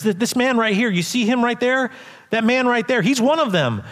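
lightly played girl singling him out; accusing servant girl. A narrator's reading. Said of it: "began again to say to the bystanders".

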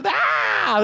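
a short yelled cry of alarm; imitating the screaming kids; mock scream. Tent collapses, kids scream.

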